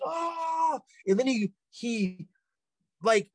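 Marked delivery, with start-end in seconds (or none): 0.0-0.8 long, high imitated wail; drawn-out high scream, acting the panic; flailing scream. Friend fighting off someone restraining him, imitated scream. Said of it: "He's flailing around like".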